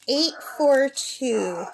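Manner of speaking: very slow, each number apart; drawn-out teaching read; slow, spelled-out numbers. Shown as a demonstration. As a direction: say it slowly so the bird copies. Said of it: "teaching parrot numbers".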